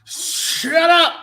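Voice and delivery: high, drawn-out mock yell; playing up a screamed catchphrase; mock screaming. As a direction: stretch it into a high holler. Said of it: "of you screaming, "Shut up, Rob".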